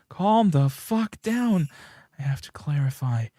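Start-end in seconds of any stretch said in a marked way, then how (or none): 0.1-1.7 louder, higher, slow forced order; furious self-command. Acted. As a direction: bite out each word to force control. Panic peaks, ordering herself.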